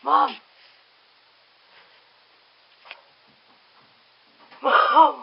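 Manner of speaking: shouting for mom; calling out loud. Real, not acted. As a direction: yell for mom across the house.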